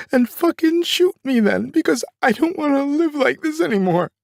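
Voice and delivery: high, loud, worn-out fury; despairing scream. Retold or imitated. Mother begging father to shoot her, high desperate scream. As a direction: scream it like she's done with life. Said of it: "she said".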